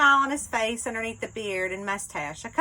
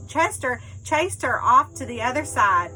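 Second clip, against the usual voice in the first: usual speaking voice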